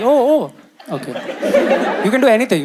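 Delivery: flustered surprise. Retold or imitated. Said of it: "I'm like".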